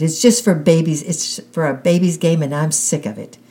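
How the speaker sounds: loud and hurt, fading at the end; angry shout. A narrator's reading. Girl refusing the children's game, angry shout. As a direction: spit it out then run off. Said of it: "I shouted".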